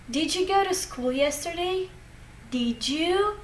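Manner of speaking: "Did you" run together as "didju"; linked J sound. Shown as a demonstration. Teacher giving an example of linking, "Did you" said as "didju". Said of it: "to change some of the sounds".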